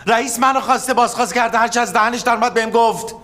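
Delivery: furious boss dressing him down; angry scolding. Acted. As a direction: lay into him for betraying you. Boss berates colleague.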